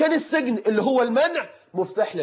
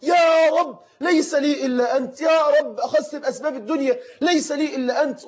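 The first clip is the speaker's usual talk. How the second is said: desperate shout; 7 semitones higher than usual. Retold or imitated. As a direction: cry out to God with everything you have. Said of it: "وأنا أصرخ بعلو ما فيا".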